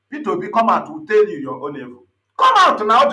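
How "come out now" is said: calling out. Real, not acted. Calling out Peter Obi.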